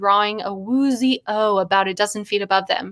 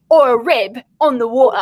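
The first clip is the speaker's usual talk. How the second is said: put-on louder British accent; 3 semitones higher, 7 dB louder than usual. Reader voicing second polo-shirt man, British accent. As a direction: finish the posh guess. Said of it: "in a British accent".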